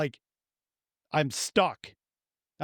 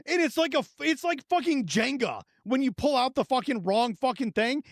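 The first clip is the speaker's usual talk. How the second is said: ordinary talk, nothing marked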